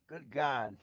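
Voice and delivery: sudden loud startled exclamation; stunned outburst under the breath. Acted. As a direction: blurt it out in shock. Narrator stunned meeting Twilight.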